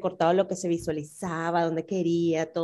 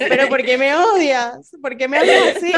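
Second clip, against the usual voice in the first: mock offense; 5 semitones higher, 8 dB louder than usual. Mock offended reaction, overinterpreting.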